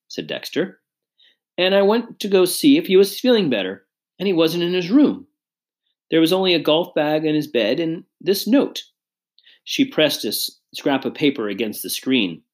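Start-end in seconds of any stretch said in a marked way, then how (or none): 1.6-8.8 higher-pitched, anxious account; mildly distressed mother telling what happened; lightly voiced worried mother. A narrator's reading. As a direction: recount the discovery with worry.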